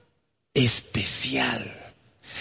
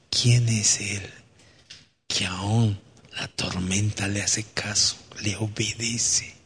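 awed hush; 3 semitones lower, 8 dB quieter, slower than usual. Acted. Disciples awed after calm.

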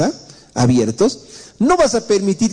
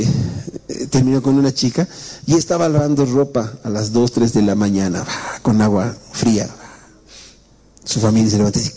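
usual speaking voice

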